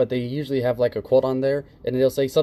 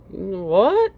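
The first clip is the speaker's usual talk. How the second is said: mock shock; 6 semitones higher, 4 dB louder than usual. Mock disbelief at the complaint.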